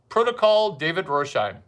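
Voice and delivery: ordinary talk, nothing marked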